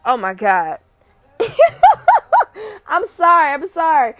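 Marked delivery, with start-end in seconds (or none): 1.4-2.8 high, loud burst of laughter; sudden shrieking laugh at her own joke. Real, not acted. 2.9-4.1 higher and louder, still giggling through it; sorry-not-sorry giggle; laughing apology. Real, not acted.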